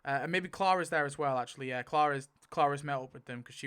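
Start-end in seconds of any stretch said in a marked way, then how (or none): none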